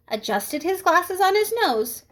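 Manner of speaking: usual speaking voice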